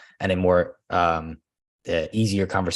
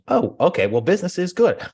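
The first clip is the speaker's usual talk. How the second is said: much higher, breezy good-news answer; upbeat agent reply; 10 semitones higher, 3 dB louder than usual. Retold or imitated. Agent replying to a client's question, much higher breezy cheer. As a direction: answer brightly, chuffed. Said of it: "How's business?".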